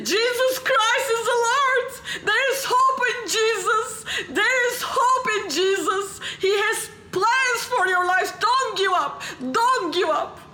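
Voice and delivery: high, impassioned proclamation; bold public preaching. Pretend. Student stands on a cafeteria table to preach, pretend fervent proclamation. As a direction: cry it out to a whole crowd.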